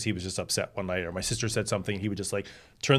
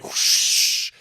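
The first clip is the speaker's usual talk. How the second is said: loud breathy whoosh; imitated whoosh; 6 dB louder than usual. Son imitates mother sweeping in with scarves, whooshing. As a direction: make a rushing swish of air.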